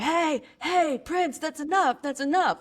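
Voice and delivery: imitating a trainer's high, sharp call to his dog. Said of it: "he's going".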